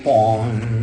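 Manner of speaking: a playful, ringing bong; light vocal boing; mock bong sound effect. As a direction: bounce the sound like a punching clown. Sound effect of clown bouncing.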